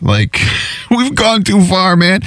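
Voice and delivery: laughing. Real, not acted. Laughing at absurd product.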